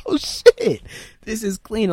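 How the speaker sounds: very high-pitched awed reaction; impressed. Retold or imitated. Viewer seeing the scarab and gun, impressed. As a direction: squeal it lightly, replaying your amazement. Retelling awe at a scene.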